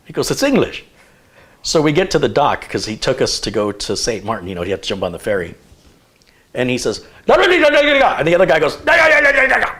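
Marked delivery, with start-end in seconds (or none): 0.3-0.9 imitated Jamaican accent, pitched much higher; a quick mock Jamaican retort. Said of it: "he's got that Jamaican accent". 7.3-8.2 imitating fast Jamaican patois as nonsense syllables, very high and loud; mock Jamaican gibberish. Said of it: "that Jamaican accent". 8.8-9.7 imitating fast Jamaican patois as nonsense syllables, very high and loud; mock Jamaican gibberish. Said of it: "that Jamaican accent".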